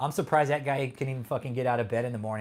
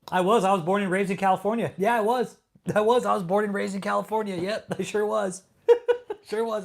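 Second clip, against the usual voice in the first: higher, louder sing-song mockery of a chatter's boast; mocking chatter; 6 semitones higher, 5 dB louder than usual. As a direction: parrot his brag back in a mocking whine.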